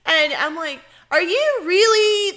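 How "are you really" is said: high, loud, drawn-out question; retold disbelief, pitched way up; mock incredulous. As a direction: stretch it out in raised disbelief.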